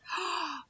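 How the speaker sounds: mock surprised gasp; big intake of breath for the children. Teacher finding new objects, gasping in surprise.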